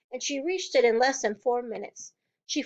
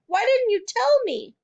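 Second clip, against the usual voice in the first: very high, loud hurt plea; wounded child; crying reproach; 11 semitones higher, 5 dB louder than usual. A narrator's reading. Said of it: "Matilda cried".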